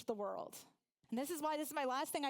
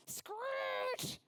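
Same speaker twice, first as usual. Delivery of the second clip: imitated tire screech; 11 semitones higher than usual. Imitates the truck braking.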